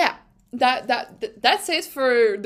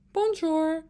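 badly faked French bonjour, said as "pahnzhoor"; a half-hearted French hello, "Bonjour" with a flat American r; imitating Otmar's bonjour; 5 semitones higher than usual. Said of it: "The man didn't even try".

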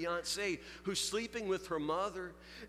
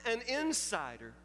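higher, insistent preaching voice, building conviction; rising sermon; 4 semitones higher than usual. Acted. Pastor urging the congregation on Jeremiah's love, preaching. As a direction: preach it to the congregation, pressing the point home.

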